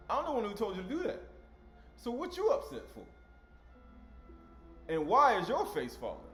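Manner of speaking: mock incredulous scolding; incredulous rebuke. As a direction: ask it as if you can't believe it. Voicing God, incredulous.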